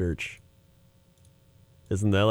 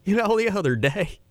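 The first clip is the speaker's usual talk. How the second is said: Joel Osteen impression; 8 semitones higher than usual. Mimicking Joel Osteen to a friend, high upbeat preacher voice. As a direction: sell it like a beaming TV preacher. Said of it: "He's like".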